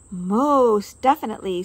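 loud, grand approval; emphatic approval. A narrator's reading. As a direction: approve with great flair. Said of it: "said Josephine".

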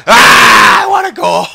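excited shout. Real, not acted. Excited during play.

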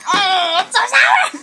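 high pained yelp, really sour; sour shock shout. Real, not acted. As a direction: cry out at the sour taste. Reacts to sour spray.

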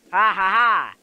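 loud, forced "ha ha ha"; mock triumphant laugh. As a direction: belt out a big fake laugh. Mock triumph after joking about cubes.

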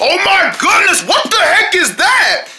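amazed. Acted. Amazed by speed.

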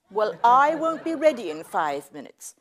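mock outrage. Sketch argument over kill time.

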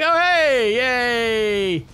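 loud, high, happy mock greeting; playful Titan. Titan come out to play with the player, gleeful. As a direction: voice an overjoyed Titan. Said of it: "it's come out to play".

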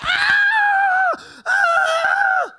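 piercing high screams of agony; scream of pain. Retold or imitated. Man who just broke his foot on the court screaming in pain. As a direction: howl like your foot just snapped.

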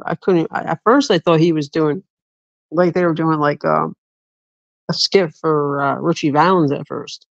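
normal talking voice

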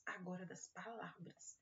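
soft whispered aside; conspiratorial whisper to the kids. Acted. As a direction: whisper it like a little secret.

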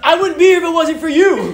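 high, loud, laughing joy; ecstatic winner. Retold or imitated. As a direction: gush like a champion. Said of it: "He's all".

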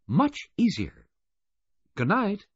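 high, loud woman's voice; Missus Baudelaire. A narrator's reading. Missus Baudelaire saying good night on the phone, high-pitched. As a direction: sign off in a high register.

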